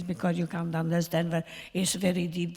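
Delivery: imitation of a mother's thick Polish accent; deep Polish accent. Shown as a demonstration. Actress shows how [name]'s mother talked, deep Polish accent. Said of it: "she was so that she talked like this".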